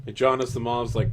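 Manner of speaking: normal talking voice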